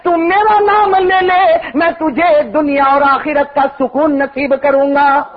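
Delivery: high, slow, sing-song sermon delivery; full-force preaching. Real, not acted. Preacher voicing the Prophet's plea for his ummah, impassioned chanting. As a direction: intone it like a plea to heaven, drawing out phrases. Intones God's promise to the believer.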